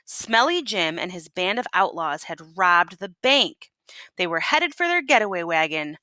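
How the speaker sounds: plain reading voice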